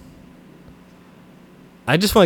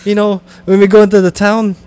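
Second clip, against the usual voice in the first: louder, higher put-on Scottish burr; mock Scottish; 4 semitones higher, 7 dB louder than usual. Friend joining the bit in a mock Scottish voice. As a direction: tease like a Scottish uncle.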